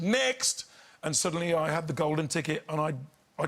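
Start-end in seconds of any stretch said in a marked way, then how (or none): 0.0-0.6 high-pitched mimicked judge; dismissive judges' call. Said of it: "they hadn't said".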